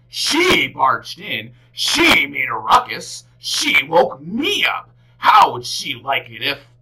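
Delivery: loud, slow, offended complaint; indignant bear. A narrator's reading. Bear grumbling loudly about the girl waking him. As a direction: huff about the girl who woke him. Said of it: "Bear was indignant".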